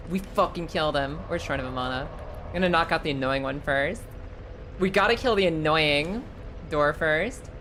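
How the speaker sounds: ordinary talk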